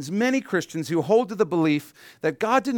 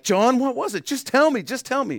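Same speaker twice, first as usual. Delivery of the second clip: high, loud, desperate begging; eager pleading; 10 semitones higher, 4 dB louder than usual. Retold or imitated. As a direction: beg like you can't wait another second. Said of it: "So we're kinda like".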